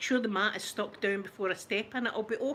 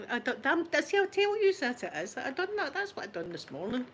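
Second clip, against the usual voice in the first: usual speaking voice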